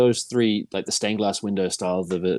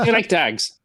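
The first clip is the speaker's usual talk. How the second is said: put-on higher-pitched Pikey accent from Snatch; mock Pikey accent; 7 semitones higher, slower than usual. Quoting the Snatch movie to the co-hosts, in a mock Irish Pikey accent. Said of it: "The Pikey".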